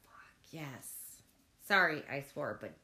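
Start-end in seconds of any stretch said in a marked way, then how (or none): 0.1-1.2 breathy whispered fuck yes, all air. Real, not acted. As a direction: whisper it so no one hears the swear. Excited swearing whispered.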